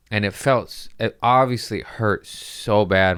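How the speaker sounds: ordinary talk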